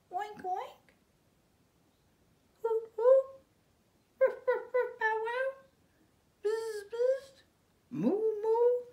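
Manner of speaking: very high, loud little animal noises; baby bird trying every magic word; high squeaky animal sounds. Acted. Bird lists all sounds.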